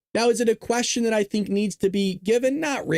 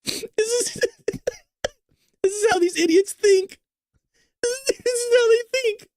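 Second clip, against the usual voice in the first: much higher, tearful laughter through words; crying laughing; 14 semitones higher than usual. Real, not acted. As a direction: laugh until you can't speak.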